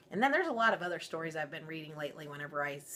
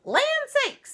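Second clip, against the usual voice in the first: much higher, louder fussy mama bear; middle-sized voice; 11 dB louder than usual. Acted. Mama Bear discovering her chair was used, high and fussing. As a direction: fluster like a shocked mother bear. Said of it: "in her middle-sized voice".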